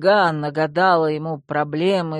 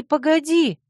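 second "погоди" jumps high and falls; stunned, higher; 11 semitones higher, 4 dB louder than usual. A narrator's reading. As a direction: stop him again, louder, falling. Heroine repeats in shock.

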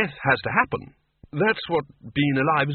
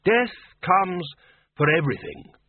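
raised, firm pronouncement with a weighty pause; 7 semitones higher, slower than usual. A narrator's reading. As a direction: state it firmly, pausing midway. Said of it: "said the Arch-Chancellor firmly".

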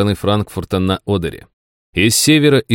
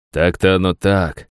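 plain reading voice

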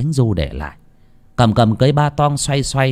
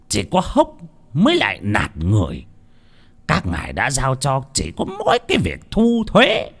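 grumbling; 6 semitones higher than usual. A narrator's reading. Old master muttering over the tax books, grumbling. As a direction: grumble to yourself over the ledger. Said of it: "miệng lẩm bẩm".

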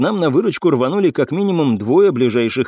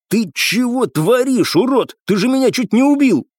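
higher-pitched, outraged reproach; indignant scolding; 10 semitones higher than usual. A narrator's reading. Ranger to the cadet who nearly killed him, indignant. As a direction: lash out at whoever nearly killed you.

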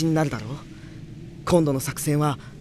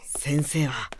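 whisper; 3 semitones lower, 6 dB quieter, much slower than usual. Acted. Sneaking inside enemy city.

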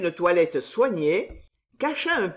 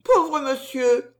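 émue; 6 semitones higher, 4 dB louder, slower than usual. A narrator's reading. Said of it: "réellement émue".

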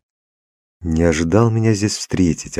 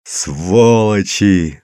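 long drawn-out roar of outrage; frenzied yell; 3 semitones higher, 4 dB louder, much slower than usual. A narrator's reading. Father curses the experimenters, yelling. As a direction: drag the curse out in a raised cry. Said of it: "заорал я не своим голосом".

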